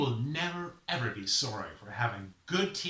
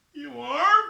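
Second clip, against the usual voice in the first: high, loud, rising question; hopeful surprise; 12 dB louder than usual. Acted. Sufferer hears that Raven is a dentist, high hopeful surprise. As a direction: ask in tearful disbelief.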